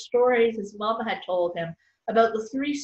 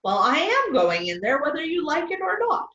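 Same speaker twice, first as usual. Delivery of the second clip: defiant; 6 semitones higher, 4 dB louder than usual. Acted. Woman defies Peter.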